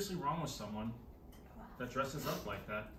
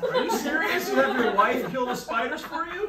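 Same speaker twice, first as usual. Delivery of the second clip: high, loud disbelief; are you serious, laughing; shocked; 13 semitones higher, 12 dB louder than usual. Real, not acted. Shocked at husband.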